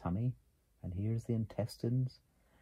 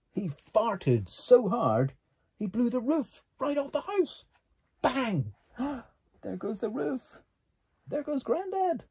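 much higher and louder, building excitement; excited climax; 13 semitones higher, 6 dB louder than usual. A narrator's reading. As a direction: burst out with the big bang. The big explosion climax.

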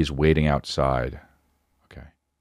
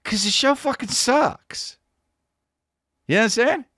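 exasperated rant. Acted. Ranting to listeners about a TV show, exasperated. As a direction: push it way up in comic fury.

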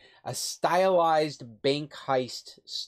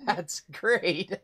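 real laughing, higher-pitched voice; amused laughter; 5 semitones higher than usual. Real, not acted. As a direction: say it laughing, genuinely tickled. Amused by the reading app.

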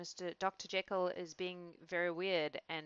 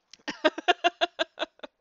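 real high loud laugh; 11 semitones higher, 12 dB louder than usual. Real, not acted. Reader laughing before restarting the chapter, real laugh. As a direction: burst out laughing.

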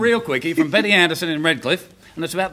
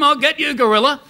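high, raised mock threat; acted threat; 8 semitones higher, 4 dB louder than usual. Furious lion chasing the gorilla, hammed-up threat. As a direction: play a lion yelling after its prey.

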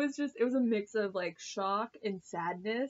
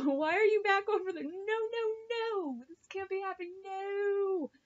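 mock crying wail; 9 semitones higher than usual. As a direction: wail in protest as if reliving the tears. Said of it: "I started crying 'cause".

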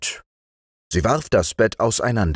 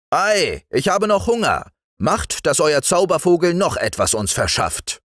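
high, lively demand; eager host; 9 semitones higher than usual. A narrator's reading. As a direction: ask keenly for another treat. Hungry host eagerly asks for more. (begeistert fordernder Wirt)

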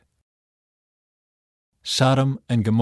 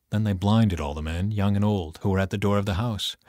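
ordinary delivery

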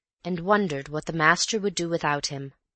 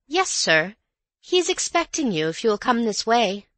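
higher, bright polite maid voice; reassuring maid; 6 semitones higher, 4 dB louder than usual. A narrator's reading. Maid greeting the rough visitor, polite and reassuring. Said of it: "smiled reassuringly at him".

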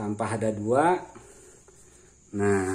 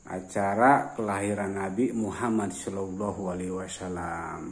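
usual speaking voice

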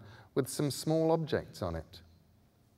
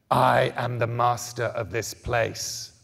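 ancient guttural voice; 6 dB louder than usual. A narrator's reading. Indigo man warning the children, slow guttural menace. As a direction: speak like something buried for ages. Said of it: "in words so ancient and guttural".

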